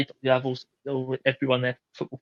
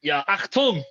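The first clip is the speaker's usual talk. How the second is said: hammed-up German announcement; mock German Achtung call; playful German accent on request; 5 semitones higher, slower than usual. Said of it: "you have to do it in German or a German accent".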